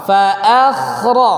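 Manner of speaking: slow "Fa akhra", a thin, kh thick; slow correct fa akhra. Shown as a demonstration. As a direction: model it slowly. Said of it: "jangan ikut-ikut".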